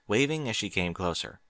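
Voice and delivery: plain reading voice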